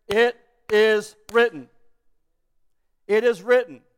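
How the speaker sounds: loud, measured scripture quote; emphatic declaration. Acted. Jesus countering the devil's temptations, loud and deliberate. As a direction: declare it firmly, spacing out the words.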